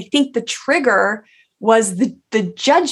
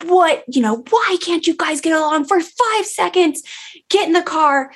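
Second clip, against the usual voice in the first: higher, mock-exasperated scolding; retold yell at kids; 8 semitones higher than usual. Mom scolding kids retold, pitch raised in mock exasperation. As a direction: replay the snap at the bickering kids, pitched up.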